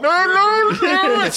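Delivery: high, loud mock babble over the top; mock droning on. Imitating a date who's still going on, high and loud. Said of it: "and she's still going".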